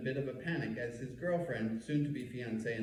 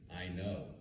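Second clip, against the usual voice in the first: flat; 5 semitones lower, 5 dB quieter than usual. Retold or imitated. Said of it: "I flatly respond knowingly".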